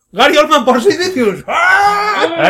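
high, loud triumphant cry; a thrilled shout capped with a high scream; excited shout. Real, not acted. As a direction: yell it like you just found him on the list. Hitting the number in a list.

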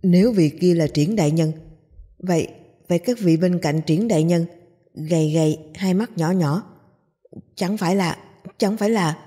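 plain reading voice

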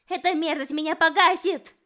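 high, loud panicked wail; panicked cry. A narrator's reading. Fire demon panics in slime.

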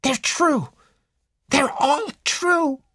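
very high, indignant, drawn-out protest; angry insistence. A narrator's reading. Ginevra insists to detective her stories are true, angrily. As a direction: insist crossly, stretching the last words. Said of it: "looked at him angrily".

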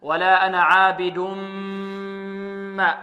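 عابد ما merged with a long hummed m; ghunna. Shown as a demonstration. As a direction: hold a nasal m between عابد and ما. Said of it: "غنة".

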